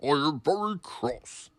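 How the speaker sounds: loud, low stern boss voice; very cross. A narrator's reading. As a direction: scold him firmly. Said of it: "said Sir Topham Hatt".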